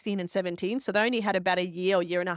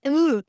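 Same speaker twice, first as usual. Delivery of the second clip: loud, raised groan of disgust; disgusted "ugh"; 6 semitones higher, 8 dB louder than usual. Retold or imitated. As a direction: recoil with a loud mock "ugh". Recalled disgust at accent remark.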